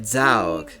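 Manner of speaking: "Zaug" said as a long, drawn-out dawg; California "dawg". Shown as a demonstration. Teacher insists on California's way, "Zaug" drawled as dawg. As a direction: stretch the vowel of "Zaug" into aw. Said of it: "they say it".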